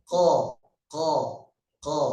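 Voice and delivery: "ko" as a heavy uvular q; separate, repeated "ko" models with Arabic qaf; qaf pronunciation. Shown as a demonstration. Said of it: "Kalau qaf".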